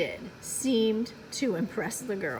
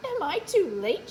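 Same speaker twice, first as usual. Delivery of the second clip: Mildred asking; 7 semitones higher than usual. Acted. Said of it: "she asked".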